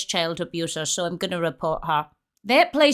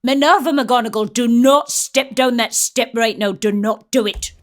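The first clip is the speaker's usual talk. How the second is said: loud, emphatic telling-off; mock stern warning; 5 dB louder than usual. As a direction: warn the character on screen, loudly. Warns film character as if scolding.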